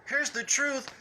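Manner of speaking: high sing-song mock politeness; mock sweet. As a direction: sugarcoat it, mocking. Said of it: "Just 'cause he won't be all".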